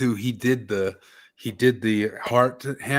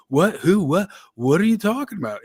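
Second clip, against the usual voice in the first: mock confusion; 7 semitones higher than usual. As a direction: sputter like you understand nothing. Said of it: "it was all just this".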